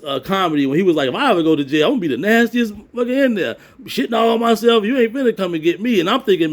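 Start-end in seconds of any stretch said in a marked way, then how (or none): 1.1-6.0 Martin Lawrence. Retold or imitated. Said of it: "like the old Martin Lawrence comedy".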